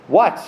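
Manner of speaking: "What?" alone, high and loud; stressed what. Shown as a demonstration. Preacher echoing God's question, "What?" punched loud. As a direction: snap out "What?" as a challenge. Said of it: "How did God ask this question?".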